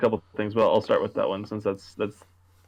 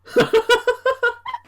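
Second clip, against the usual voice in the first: squealing high laugh, rising; can't stop laughing; high burst of laughter; 5 dB louder than usual. Real, not acted. Laughing at the joke.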